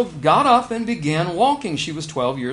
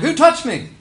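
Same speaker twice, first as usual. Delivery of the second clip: raised, higher, insistent "Who touched me?"; urgent questioning Jesus; 8 semitones higher, 5 dB louder than usual. Retold or imitated. Jesus demanding who touched him in the crowd, urgent. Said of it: "because he's like".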